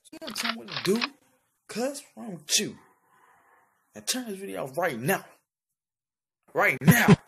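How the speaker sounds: loud, high-pitched demand; irritated confrontation. Acted. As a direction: raise your voice and order them to stop. Acted quarrel over filming the video.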